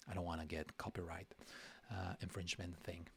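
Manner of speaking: quiet aside. Real, not acted.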